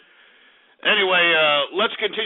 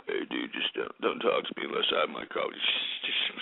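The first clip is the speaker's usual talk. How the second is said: much lower, much softer groggy mumble, fading to a whisper; mock grumpy coffee addict; 8 dB quieter than usual. Ranting host mimics people who need coffee, in a low groggy mumble. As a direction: drag the words out like a half-awake grump begging to be left alone.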